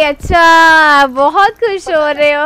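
loud, slowly drawn-out sarcasm; loud sarcastic jab. Real, not acted. Streamer calling out teammates gloating over her death, loud and sarcastic. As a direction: throw it back at them, mock-offended.

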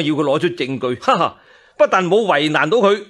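plain reading voice

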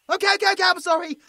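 high, loud flustered okays; hasty apology. Acted. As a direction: back off fast, apologizing in a rush. Appeasing an offended werewolf.

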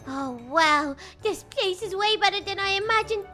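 ordinary delivery

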